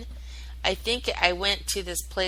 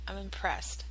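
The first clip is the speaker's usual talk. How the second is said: much softer, murmured to herself; quiet, smug aside; 10 dB quieter than usual. Real, not acted. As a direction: drop the volume and savor it privately. Repeats praise of herself.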